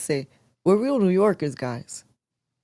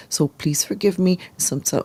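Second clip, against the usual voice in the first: usual speaking voice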